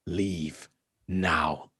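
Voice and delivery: lightly voiced rasping elf; low cold warning from a stranger.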